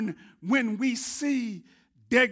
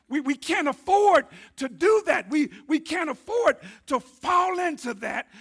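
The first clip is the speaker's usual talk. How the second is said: louder, pushed-out preaching; forceful emphasis from the pulpit; added emphasis; 6 semitones higher, 6 dB louder than usual. Real, not acted. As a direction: lean hard into the warning. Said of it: "I really need to put some emphasis on that".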